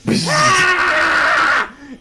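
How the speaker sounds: loud, high falling shriek; disintegration scream. Acted. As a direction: scream out as the beam hits. Victim is disintegrated by a beam.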